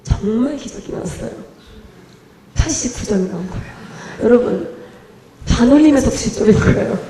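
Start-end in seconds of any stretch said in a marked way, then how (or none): none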